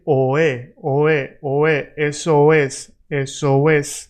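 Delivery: oe, oe gliding smoothly into eso es; linked vowels. Shown as a demonstration. Teacher showing the right way to link Eso es, smoothly. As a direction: glide o into e with no break in eso es. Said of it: "I want you to say".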